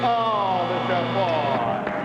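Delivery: disappointed groan. Real, not acted. Contestant drops plate, host groans.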